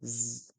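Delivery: "Z" as a sustained voiced buzz; isolated buzzing "Z", no vowel; sound of Z. Shown as a demonstration. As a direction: hold "Z" like a buzz. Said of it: "et le son".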